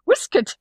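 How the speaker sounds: "whisket" for waistcoat, short i, squashed second syllable; Irish pronunciation. Retold or imitated. Quoting Irish grandma's one word, "whisket" with short Irish vowels. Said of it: "the one word that had stayed Irish".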